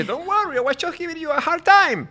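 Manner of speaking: high, playful sing-out; exaggerated high-pitched reassurance; joking traveler. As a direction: act out brushing it off in a high voice. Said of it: "entonces usted dice".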